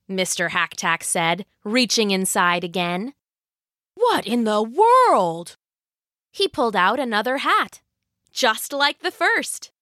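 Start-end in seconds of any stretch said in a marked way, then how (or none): 3.9-5.6 slow, swooping disbelief; bewildered husband, stretched words; baffled. Acted. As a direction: drag the words out in puzzlement. Husband finds a second hat.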